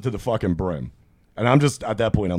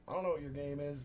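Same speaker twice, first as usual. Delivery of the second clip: uneasy hush; 5 semitones higher, 18 dB quieter than usual. Retold or imitated. Backing away from weird bartender.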